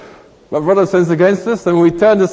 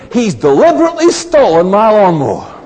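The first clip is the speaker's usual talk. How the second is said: mock indignant suspicion; 5 semitones higher, 5 dB louder than usual. Voicing the suspicious thought.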